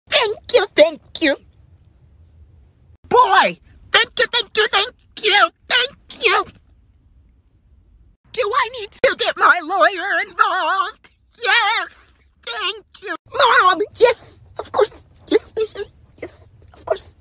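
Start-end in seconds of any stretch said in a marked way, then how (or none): 0.1-6.4 high sassy sing-song thank yous; mock-polite chorus of thank yous, voice up high. Mocking repeated thank yous. 11.4-17.1 high, eager yes-man cheers; squeaky cheerleading yeses; high excited cheering. Acted. Kids egging on mom loudly.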